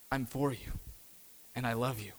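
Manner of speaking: very soft, low and intimate; hushed, low voice of comfort; tender reassurance. Acted. God to the one who feels hopeless, tender hushed reassurance.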